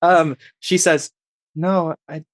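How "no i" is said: put-on quiet French accent; a soft French lilt. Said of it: "she's speaking in a French accent".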